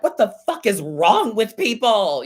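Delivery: high, loud outburst of disbelief; exasperated venting. Retold or imitated. Streamer vents to husband after coming off the live, loud exasperation.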